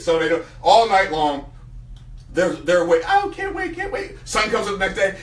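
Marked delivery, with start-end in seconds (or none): 3.1-4.2 high chick voice, softer; a little bird bursting with anticipation; eager baby eagle. Acted. Eaglet awake all night, high eager voice.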